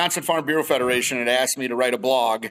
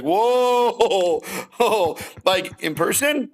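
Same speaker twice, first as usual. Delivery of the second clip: higher, drawn-out startled backpedal; taken aback; 5 semitones higher, much slower than usual. Retold or imitated. Man asked to speak on men's mental health, taken aback. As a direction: balk at it with a laugh.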